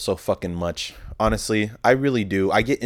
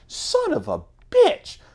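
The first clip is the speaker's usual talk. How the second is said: mock outburst; slower than usual. Lady curses after losing cart.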